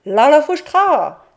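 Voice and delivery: high, loud shouted la la fouchtra; shouted call. Retold or imitated. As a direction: shout it out at the door. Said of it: "tu crieras". (la mère dicte le cri à crier)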